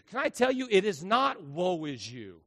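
normal talking voice

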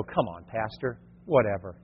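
mock bored congregant; dismissive brush-off. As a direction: wave the pastor off. Mocking congregant brushing pastor off.

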